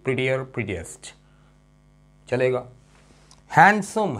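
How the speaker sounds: ordinary talk, nothing marked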